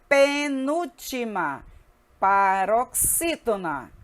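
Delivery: drawn-out, separated syllables; deliberate teacher drill; slow repetition drill. Shown as a demonstration. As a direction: repeat both terms slowly, syllable by syllable. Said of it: "Repetindo mais uma vez".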